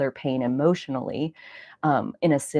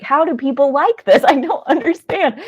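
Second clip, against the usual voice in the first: very high, loud incredulous squeal; baffled disbelief; 11 semitones higher, 9 dB louder than usual. Real, not acted. Viewers told about fight fans, high loud disbelieving squeal. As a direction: squeal it like you can't believe anyone enjoys this. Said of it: "exasperated disbelief about fight sports".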